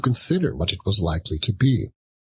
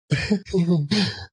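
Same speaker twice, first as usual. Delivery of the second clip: high cackle; 10 semitones higher than usual. A narrator's reading. Charwoman cackles.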